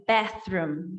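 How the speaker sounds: ordinary talk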